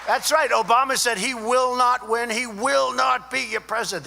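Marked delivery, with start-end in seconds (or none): 1.2-4.0 loud, higher imitation of furious insistence; imitating Obama's angry vow to the crowd. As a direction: hammer it out like a bitter rival. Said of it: "The anger was unbelievable".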